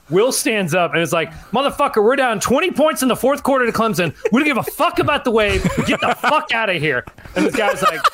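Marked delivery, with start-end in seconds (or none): 1.5-7.0 laughing retold rant at a stranger; angry yell. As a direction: tell the shoeless guy off, half laughing. Will stands up and yells.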